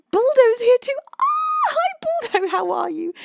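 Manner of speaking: high, loud excited squeal; delighted greeting. Real, not acted. Streamer spotting a regular viewer arriving, delighted. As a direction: squeal hello to a friend, pitch soaring.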